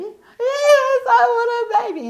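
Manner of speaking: high, loud mock sobbing answer; imitated crying wail. Crying girl answering the preacher, imitated wail. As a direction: bawl it out, hammed-up. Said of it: "what are you crying about".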